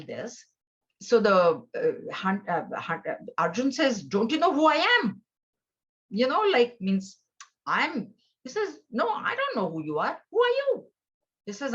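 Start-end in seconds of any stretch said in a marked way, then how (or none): 4.0-5.2 haughty, quick and raised; who do you think I am, louder and higher; arrogant demand. Retold or imitated. Proud prince demands recognition. 8.9-10.8 the last question leaps much higher, incredulous; mocking question. Hunter mocks the prince.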